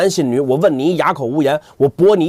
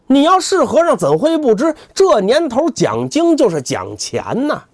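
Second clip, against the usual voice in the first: sneering lecture; 7 semitones higher than usual. Acted. 扫地僧讥讽.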